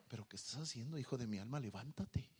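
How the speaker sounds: hushed, low fatherly urging; low, very soft voice of God; gentle rebuke. Acted. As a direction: drop right down and coax them up softly. God's rebuke replayed softly.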